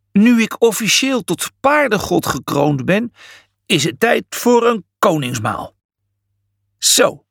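boastful horse god voice; proud Julius. A narrator's reading. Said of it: "zei Julius trots".

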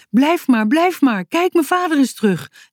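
put-on child calling out joyfully. A narrator's reading. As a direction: tell everyone the happy news. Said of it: "riep Boutje".

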